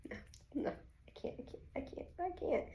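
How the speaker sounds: breathless whispered refusal; whispering. Real, not acted. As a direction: whisper it, half panicked. Panicking at the thought of family.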